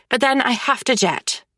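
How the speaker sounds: ordinary delivery, nothing marked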